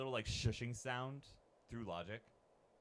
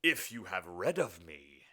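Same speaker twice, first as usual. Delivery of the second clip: demon naming terms; 2 semitones lower, 5 dB louder, much slower than usual. Acted. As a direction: intone it slowly, drawing out each word. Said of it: "This will be the demon".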